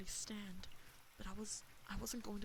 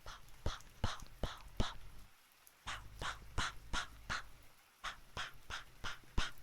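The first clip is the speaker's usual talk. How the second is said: airy popping gunshot noises; gunfire pops; 4 dB louder than usual. Pretend. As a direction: pop out each shot like a rifle. Mimicking rifle shots.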